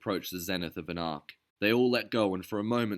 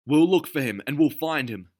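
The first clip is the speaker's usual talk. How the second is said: higher, louder resolute voice; determined vow; 8 semitones higher, 9 dB louder than usual. A narrator's reading. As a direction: promise it fiercely. Derville vowing in battle.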